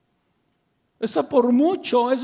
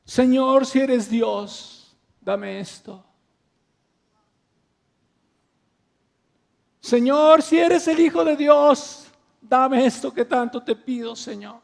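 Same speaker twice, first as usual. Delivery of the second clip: demanding prayer; 3 semitones higher, 5 dB louder, slower than usual. Retold or imitated. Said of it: "lo digo con tristeza".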